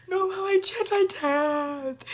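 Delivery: high, slow teary wail; crying. Retold or imitated. Correcting her mom on the phone, a high slow sob. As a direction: plead through sobs.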